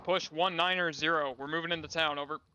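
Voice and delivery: ordinary delivery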